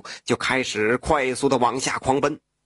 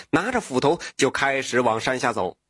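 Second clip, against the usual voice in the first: ordinary delivery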